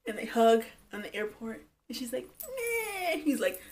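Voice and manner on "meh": very high little bleat; mock whimper. Darcy hugging at the airport, mock whimper. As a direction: squeak out a mock hug noise.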